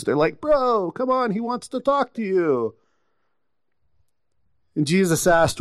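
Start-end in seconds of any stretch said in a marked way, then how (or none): 0.4-2.7 high-pitched, coaxing mock chumminess; suddenly friendly. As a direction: imitate followers who suddenly change their tune. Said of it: "all of a sudden their tune changes".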